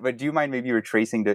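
ordinary talk, nothing marked

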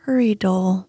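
sleepy storyteller reading gently; bedtime story narration. A narrator's reading. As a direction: lull them to sleep as you read.

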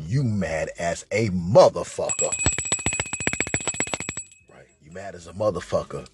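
ordinary talk, nothing marked

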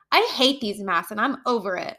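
ordinary talk, nothing marked

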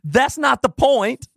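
high, slow insistent wife voice; mock wife retort. Wife insisting in the parking lot, high and slow. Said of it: "To which she responds".

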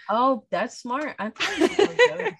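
slow, high and loud through laughter; real laughter running through the line; laughing. Real, not acted. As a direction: laugh the words out, delighted. Laughing at the Australian trick.

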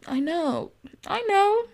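mock smug. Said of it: "Not in a cocky way".

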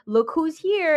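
high, loud put-on delight; mock excited reveal. Mother turning the phone to son, mock excited. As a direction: imitate a showy surprise announcement.

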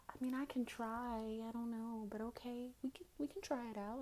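feigned innocence, quiet and high-pitched; playing dumb. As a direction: play the clueless novice, softly. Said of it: "I'ma play the dumb role".

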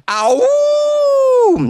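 sharp high shouted ow. Retold or imitated. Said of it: "That was my impression of [name]".

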